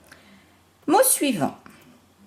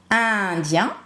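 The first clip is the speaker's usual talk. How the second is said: "indien" slower, first syllable held, iiin-dien; stretched syllables. Shown as a demonstration. Teacher showing pupils the sound, "indien" slowly stretched. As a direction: draw out "indien" in two clear syllables. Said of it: "j'entends le son I dans indien".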